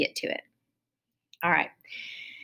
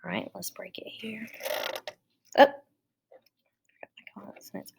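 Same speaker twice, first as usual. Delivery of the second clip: muttering to herself while writing; hushed thinking aloud; quiet working aside; whispered aside; 7 dB quieter, faster than usual. Real, not acted. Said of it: "working quietly on the board".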